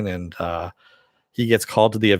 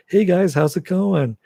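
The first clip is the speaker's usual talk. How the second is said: higher, slower, breezy Bruce Wayne greeting; light Bruce Wayne; 9 semitones higher, 4 dB louder, slower than usual. Retold or imitated. Showing Conroy's light Bruce Wayne voice, high and airy. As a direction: play the carefree playboy, pitched up. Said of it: "the really light version of Bruce Wayne going like".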